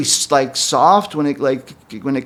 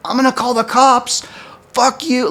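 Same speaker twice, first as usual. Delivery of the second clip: imitated screaming threat; 9 semitones higher than usual. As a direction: imitate her screaming at you, pitched way up. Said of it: "she starts fucking screaming at me".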